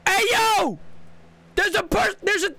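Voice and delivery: very high, loud shout; alarmed yell. Real, not acted. Gamer spotting someone and yelling in alarm.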